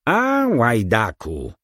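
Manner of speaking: slow, drawn-out mock scream of rage; hammed-up cry of a startled old man; narrated scream. A narrator's reading. Baron screams at the young man he suspects. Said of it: "wrzasnął".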